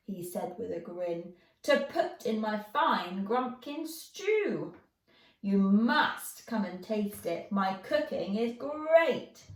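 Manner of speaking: a friendly troll bragging about his stew. Acted. Troll voiced, proud cook.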